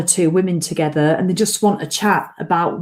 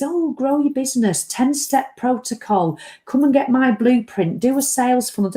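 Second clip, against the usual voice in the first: higher-pitched parody of business ads; mocking sales pitch; 5 semitones higher than usual. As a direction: reel off marketing slogans in mockery. Mocks online business adverts she hates.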